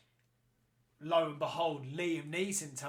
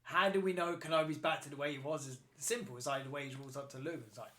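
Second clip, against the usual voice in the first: ordinary delivery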